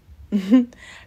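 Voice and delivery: short high, loud mock shriek; a friend snatched, a replayed scream of panic; imitated scream. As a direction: let out a quick retold scream. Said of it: "Jessica screams".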